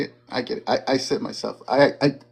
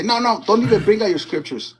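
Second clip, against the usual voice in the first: higher imitation of dismissive opponents; acted-out protest, pitched up; mock refusal; 5 semitones higher than usual. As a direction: imitate someone shutting the argument down. Acts out opponents refusing scripture.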